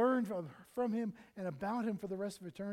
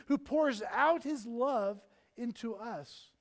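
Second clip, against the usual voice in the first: slow and louder, each word pressed down; emphatic slow preaching; 2 semitones higher, 6 dB louder, much slower than usual. Real, not acted. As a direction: lean hard on every word. Preacher stressing God's love.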